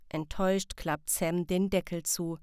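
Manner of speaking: usual speaking voice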